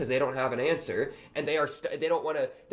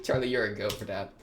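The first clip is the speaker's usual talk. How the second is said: usual speaking voice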